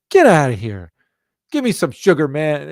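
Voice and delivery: loud, high playful imitation; hearty hug demand. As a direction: boom it out like an affectionate uncle. Retelling John Carlos refusing handshake.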